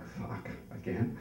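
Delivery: slow, hushed whisper of dread. Retold or imitated. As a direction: mutter it so nobody upstairs hears. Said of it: "I'm like".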